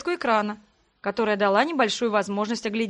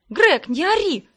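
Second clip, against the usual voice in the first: barking; 7 semitones higher, 6 dB louder, slower than usual. A narrator's reading. Sister barking at screaming brother while falling. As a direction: snap it out loud and high. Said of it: "рявкнула я ему".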